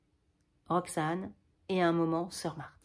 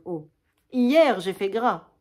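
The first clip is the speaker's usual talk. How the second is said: vantardise lente et bien plus forte; voix fanfaronne; 2 semitones higher, 11 dB louder, slower than usual. Acted. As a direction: se vanter en traînant exprès. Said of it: "D'une voix fanfaronne". (fanfaronner devant la sœur, lent et sonore)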